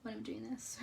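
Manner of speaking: soft whispered aside. Real, not acted. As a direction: whisper it under your breath. Careful coloring aside.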